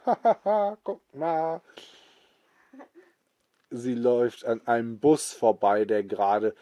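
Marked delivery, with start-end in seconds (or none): 0.0-1.6 high cackling laugh then a show-off call; granny laughing. Acted. Grandma laughing as she runs past the cars, high laugh. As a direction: laugh as you race past. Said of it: "Die Oma lacht".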